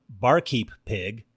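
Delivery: ordinary delivery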